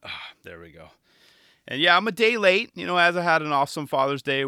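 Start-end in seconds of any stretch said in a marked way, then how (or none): 0.0-0.9 strained while stretching; stretching groan. Real, not acted. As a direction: talk mid-stretch, softer. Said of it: "I'm stretching a little bit".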